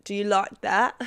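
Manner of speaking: voicing David Beckham talking you through it; British lover. Beckham in bed, talking you through it, loud. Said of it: "he's got the accent, he's British".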